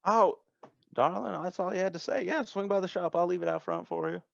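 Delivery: high voice, easygoing and helpful; obliging shop owner. Acted. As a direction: agree readily as a small-town shop owner on the phone. Game master plays Denise agreeing.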